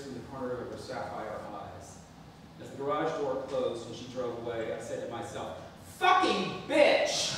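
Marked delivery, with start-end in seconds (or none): 6.0-7.3 loud, higher, slow furious curse; angry curse. Retold or imitated. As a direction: spit it out slowly and angrily. Cursing mother after she leaves.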